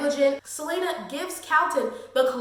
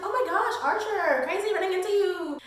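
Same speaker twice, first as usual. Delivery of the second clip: fake surprise; 6 semitones higher than usual. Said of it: "And she's like".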